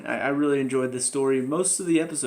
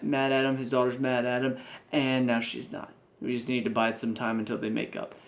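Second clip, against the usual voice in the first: ordinary talk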